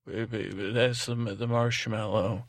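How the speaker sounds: ordinary talk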